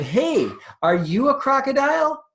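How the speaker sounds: put-on baby elephant calling to the crocodile; squeaky baby elephant. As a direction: call out like an excited young elephant. Baby elephant voiced in story.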